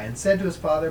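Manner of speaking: usual speaking voice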